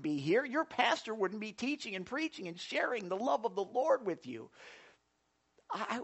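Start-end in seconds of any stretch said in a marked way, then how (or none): none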